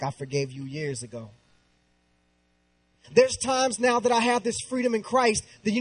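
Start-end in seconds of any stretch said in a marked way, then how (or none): 0.0-1.3 low, soft imitation of a forgiving mother; hushed, much lower quoted reply; gentle forgiveness. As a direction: retell her reassurance very quietly. Voices mom forgiving him.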